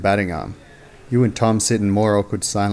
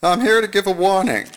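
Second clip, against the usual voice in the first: put-on old radio announcer; 10 semitones higher than usual. Commissioner's TV warning.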